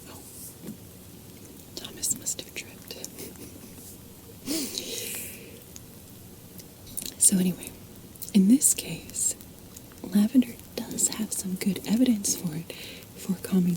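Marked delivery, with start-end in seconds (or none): none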